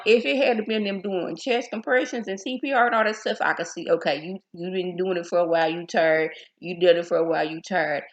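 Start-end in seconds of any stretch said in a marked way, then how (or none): none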